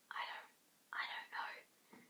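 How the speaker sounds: slow, unvoiced whisper. Real, not acted. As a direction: whisper it, trailing off. Whispered trailing aside.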